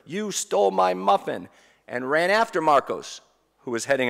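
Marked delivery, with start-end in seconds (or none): 0.1-1.5 mock child's yell, higher and louder; a touch of a boy screaming "You stole my muffin"; retold scream. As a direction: yell it like a robbed ten-year-old. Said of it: "he screamed as loud as he could".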